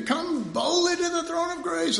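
high mock proclamation of the verse; quoted invitation. Preacher quotes the verse, high mock herald. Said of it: "we hear".

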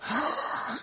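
a sharp, breathless gasp of alarm; shocked gasp. Retold or imitated. Guard points at the three men and gasps in shock. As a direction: gasp as if catching someone defying the king. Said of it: "he was pointing at these three going".

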